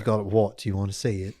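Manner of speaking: soft, low put-on British voice; British accent. Wart boy introducing his wart after co-host's suggestion, British accent. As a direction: say it softly in a mock British accent. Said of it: "in a British accent".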